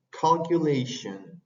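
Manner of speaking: "calculation" slow, LAY stressed, last e almost gone; slow model word. Shown as a demonstration. Teacher repeating for the class, slow "calculation". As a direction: stretch "calculation" carefully. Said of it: "Esa E al revés es una E corta".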